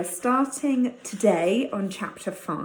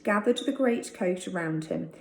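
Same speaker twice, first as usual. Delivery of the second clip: plain reading voice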